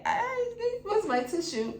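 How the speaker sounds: high voice, welling up; on the verge of tears; about to cry. Real, not acted. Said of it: "I'm gonna cry again now".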